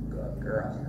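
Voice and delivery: low, soft praise for a horse; gently calm the horse; soothing to a horse. Real, not acted.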